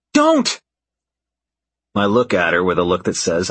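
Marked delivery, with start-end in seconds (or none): 0.1-0.6 high, loud cry to stop; urgent yell. A narrator's reading. Scarlett yells at Ford not to shoot, loud high yell. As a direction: yell it as a desperate order. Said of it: "Scarlett yells".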